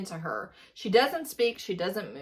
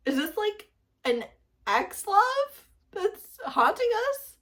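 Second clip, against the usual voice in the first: disbelief; 11 semitones higher, slower than usual. Real, not acted. Incredulous question about the plot.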